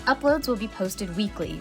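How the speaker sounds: ordinary delivery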